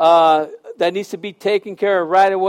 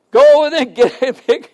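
laughing; 8 semitones higher, 7 dB louder, faster than usual. Real, not acted. Laughing exhortation.

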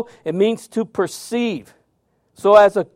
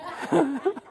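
high giggle; 10 semitones higher, 8 dB quieter than usual. Real, not acted. Laughing at story.

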